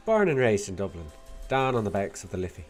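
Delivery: put-on Irish accent with a Scottish lilt; attempted Dublin accent. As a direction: do a not-very-good Dubliner. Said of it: "Not very good at Dublin accent".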